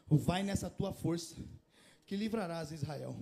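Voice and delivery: soft, slow, reassuring; tender divine reassurance; gentle voice of God. Acted. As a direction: speak as God comforting Gideon. God's reply to Gideon.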